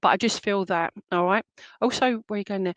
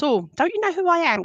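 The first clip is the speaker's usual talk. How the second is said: mock self-important, pitched way up; big-headed ego; 8 semitones higher than usual. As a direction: play someone full of their own ego, voice swooping high. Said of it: "kind of ego".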